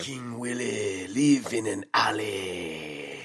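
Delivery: slow, drawn-out bad Jamaican voice; stretched-out mock Jamaican gangster line; Jamaican accent. Host mimicking voodoo gangster for laughs, slow Jamaican accent.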